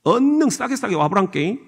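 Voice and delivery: Jeolla accent, urging them along; folksy Jeolla lilt; Jeolla dialect. Shown as a demonstration. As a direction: beckon like a Jeolla local. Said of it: "전라도는".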